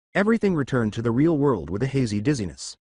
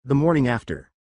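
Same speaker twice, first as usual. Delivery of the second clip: plain reading voice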